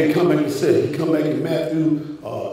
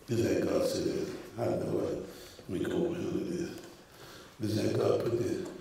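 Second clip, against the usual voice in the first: unvoiced aside under the breath; hushed whisper to himself; 11 semitones lower, 17 dB quieter than usual. Real, not acted. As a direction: drop to a private whisper, much softer. Muttered aside off the pulpit.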